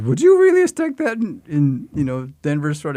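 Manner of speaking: loud, high-pitched disbelieving question; astonished director. Retold or imitated. Director asks the actor about the threat with the knife, astonished. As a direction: imitate a director's incredulous outburst.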